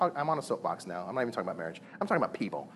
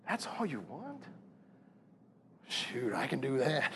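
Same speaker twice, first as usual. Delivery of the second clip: relieved disbelief; 8 dB quieter, slower than usual. Retold or imitated. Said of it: "I'm like".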